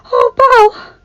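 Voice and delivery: high, loud cry of reunion; emotional outcry. A narrator's reading. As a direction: cry out her name as you rush to hug her. Tearful friend rushes into a hug.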